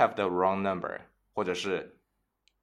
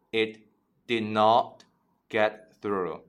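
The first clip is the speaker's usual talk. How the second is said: slow chunked repeat; slower than usual. Shown as a demonstration.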